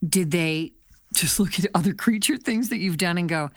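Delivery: ordinary delivery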